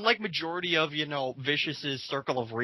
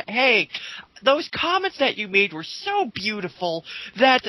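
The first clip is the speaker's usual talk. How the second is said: higher, sugary mock-friendly tone; sarcastic sweetness; 6 semitones higher than usual. Taunting the rival, higher sarcastic sweet voice.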